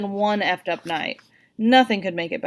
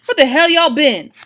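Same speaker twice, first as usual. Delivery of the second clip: loud, higher angry demand; 8 semitones higher, 9 dB louder than usual. A narrator's reading. Father confronting kids after the fire, loud demanding. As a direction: demand it at full volume.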